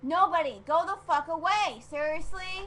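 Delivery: high, loud irritation; exasperated yell. Real, not acted. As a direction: really yell at your brother to get out. Yells at brother to leave.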